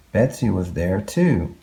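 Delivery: plain reading voice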